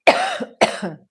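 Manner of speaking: sharp spluttering cough on a drink; real cough, drink down the wrong way; choking cough. Real, not acted. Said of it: "hot drink went down the wrong way".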